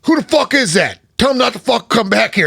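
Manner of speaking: high, loud furious yell; angry chief. Retold or imitated. Chief woken at night yelling about the microphone, furious. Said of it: "And he's like".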